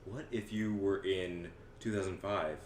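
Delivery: usual speaking voice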